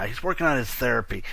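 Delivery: quiet aside. Real, not acted. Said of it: "he's working on his therapy right now".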